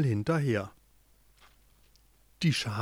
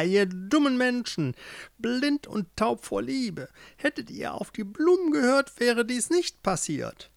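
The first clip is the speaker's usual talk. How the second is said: gloating witch; 8 semitones higher than usual. A narrator's reading. Witch mocks lovers blind with love, gloating. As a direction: scoff in a high witch voice. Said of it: "Die Hexe lachte".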